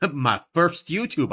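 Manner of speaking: slow drawled Southern American twang; exaggerated American drawl; mock American accent. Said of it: "you couldn't do American accents".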